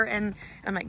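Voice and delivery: ordinary talk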